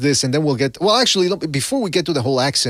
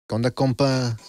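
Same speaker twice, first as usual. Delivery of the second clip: a quick norteño Mexican greeting, a bit softer; norteño accent; 2 semitones lower, 4 dB quieter than usual. Shown as a demonstration. Redoing the accent as norteño for the chat, a casual greeting. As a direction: toss it off like a norteño buddy. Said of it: "That, okay, norteño".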